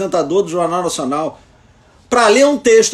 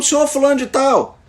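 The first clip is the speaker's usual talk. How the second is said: higher, attention-getting address; calling by name; 6 semitones higher than usual. Shown as a demonstration. Trainer shows how to call an inattentive judge, calling by name. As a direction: call him politely but pitched up. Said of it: "Se você chamar pelo nome".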